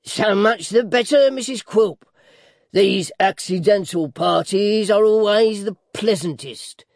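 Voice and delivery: high, loud character voice; gleeful dwarf, stretching the words out; gloating dwarf. A narrator's reading. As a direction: relish it with mock delight. Voices dwarf Quilp in reading.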